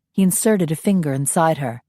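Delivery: plain reading voice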